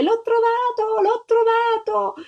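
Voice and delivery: high, drawn-out cry of joy; excited shout. A narrator's reading. Little girl crying out at finding the egg, excited shout. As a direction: call it out high and long, wild with happiness. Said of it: "si mise a gridare".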